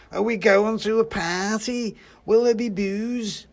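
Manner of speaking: high, loud, slow tipsy voice; excited boozy Christmas pudding; drunken pudding. A narrator's reading. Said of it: "has definitely been drinking".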